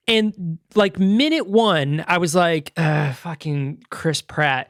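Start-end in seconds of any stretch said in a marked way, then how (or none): 2.8-4.7 slow, softer groan of reluctant annoyance; retold exasperation. Moviegoer recalls first minute of Chris Pratt, exasperated.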